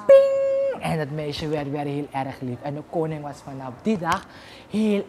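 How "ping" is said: very high ping effect; a bright high ping; magic ping. Acted. Spell undone with a high magic ping.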